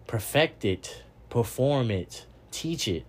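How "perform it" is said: normal talking voice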